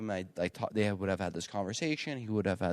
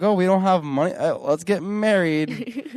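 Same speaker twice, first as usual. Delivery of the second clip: high, loud mock carefree voice; 9 semitones higher, 9 dB louder than usual. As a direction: toss it off like a careless newlywed. Mocking Russian friends.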